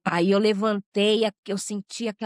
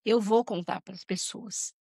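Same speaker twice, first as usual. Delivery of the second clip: emotional prayer. Real, not acted. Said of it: "Essa eu vou me emocionar".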